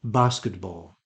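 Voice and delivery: Basketball as BAH-skit-ball; received pronunciation. Shown as a demonstration. Teacher giving the dictionary form, received pronunciation. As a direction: say Basketball with a long ah. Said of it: "the received pronunciation is a".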